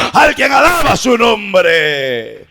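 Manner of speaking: slow shout with a long, falling last word; climactic praise shout. Real, not acted. Preacher calls congregation to praise, shouting at the climax. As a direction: stretch the final word into a drawn-out cry.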